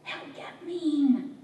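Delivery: high, slow, drawn-out urgent warning; hissed warning. A narrator's reading. Sister warning about their drunk father, acted hiss. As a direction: stretch it out as a sister's hissed caution. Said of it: "my sister hissed".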